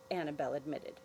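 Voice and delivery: plain reading voice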